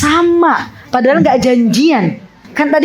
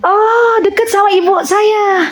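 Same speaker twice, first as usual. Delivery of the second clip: much higher, excited exclamation; light up at the shared hometown; delighted surprise; 8 semitones higher than usual. Real, not acted. Host discovering the guest lives near her mother, delighted.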